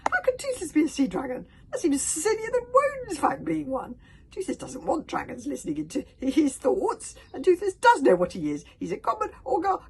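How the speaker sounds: very high, loud little dragon; stammering, indignant squeal; distressed Toothless. A narrator's reading. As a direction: protest frantically in a squeaky voice. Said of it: "he was so distressed he spoke aloud".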